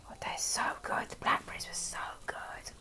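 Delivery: hushed, very soft whisper; whispered aside. Real, not acted. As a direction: whisper it like a secret treat. Hushed aside about the blackberries.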